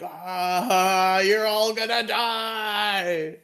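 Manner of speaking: high, slow, menacing gloating threat; villain gloat. Retold or imitated. Goldar gloating at the heroes in hammed-up menace. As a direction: ham up a cartoon villain. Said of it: "Goldar's like".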